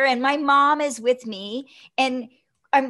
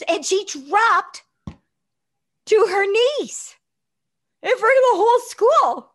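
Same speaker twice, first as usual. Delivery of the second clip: building excitement; 8 semitones higher, 5 dB louder than usual. Real, not acted. Mom drops to her knees.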